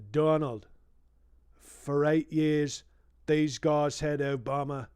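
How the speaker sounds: put-on a light, slowed Australian accent for Murdoch. Comedian reading Murdoch's line in a hinted Australian accent. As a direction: stretch out "eight years" in a mild Australian accent. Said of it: "I'll do an Australian accent for Murdoch".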